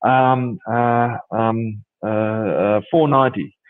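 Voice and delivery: halting, hesitant price quote; mock nervousness. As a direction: stall with ums before naming the figure. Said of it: "I'd be all nervous".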